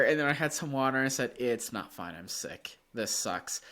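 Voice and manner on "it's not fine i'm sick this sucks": much softer and lower, sinking; retold defeated groan. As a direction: give up on it, deflated. Retold realizing he's sick.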